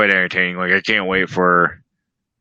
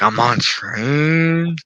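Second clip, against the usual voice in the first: high, very slow spaced-out admission; drawn-out confession; 6 semitones higher, much slower than usual. Retold or imitated. Telling Jess, slow high dazed confession. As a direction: stretch it like you're tripping. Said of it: "And I'm like".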